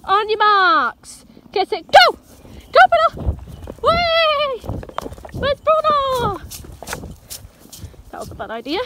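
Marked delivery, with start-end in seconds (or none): 0.0-2.2 slow, stretched "marks", then a louder "go"; excited race start with the dog, slow then loud; drawn-out countdown. Real, not acted. 2.7-6.4 very high, excited cheer; high playful calls to the dog. Real, not acted.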